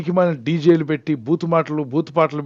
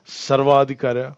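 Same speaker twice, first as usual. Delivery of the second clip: సర్వాధికారా drawn out slowly; stress on సర్వాధికారా; slower than usual. Real, not acted. Preacher asks congregation if God has small authority, stressing సర్వాధికారా slowly. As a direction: stretch సర్వాధికారా as a pointed question.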